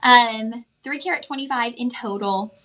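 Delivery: ordinary delivery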